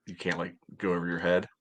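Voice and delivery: normal talking voice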